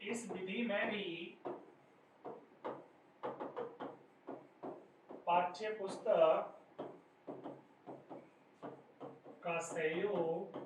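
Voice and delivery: drawn-out words with long pauses; slow dictation. Real, not acted. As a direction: dictate it slowly as if writing along. Dictating slowly while writing it out.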